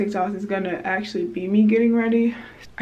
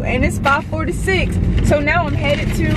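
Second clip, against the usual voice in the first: ordinary talk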